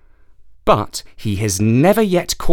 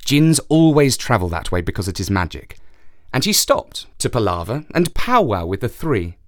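ordinary delivery

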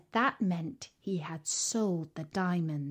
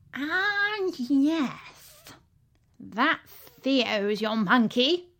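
zoo keeper; 4 semitones higher, 4 dB louder, much slower than usual. A narrator's reading. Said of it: "said Mister Shingles".

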